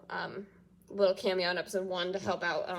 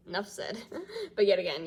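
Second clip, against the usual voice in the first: ordinary talk, nothing marked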